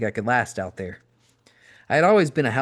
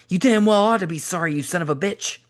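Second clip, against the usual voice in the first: high, loud furious snarl; raging shout; 8 semitones higher, 4 dB louder than usual. A narrator's reading. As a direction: spit it at him in pure rage. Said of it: "with rage in his eyes".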